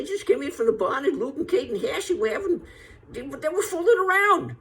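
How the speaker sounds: put-on very high, fast cackly old-man voice; Walter Brennan sixties voice. Comic quoting Walter Brennan's later voice, very high and fast. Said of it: "He had a voice like this".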